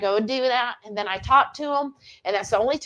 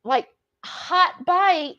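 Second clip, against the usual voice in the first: imitating Mary; 9 semitones higher than usual. Imitating Mary's cooking tip.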